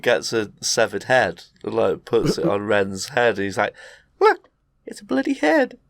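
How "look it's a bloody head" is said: much higher silly ghost voice; cartoon ghost voice. Retelling the haunting ghost, much higher cartoon voice. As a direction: pipe it up high and goofy.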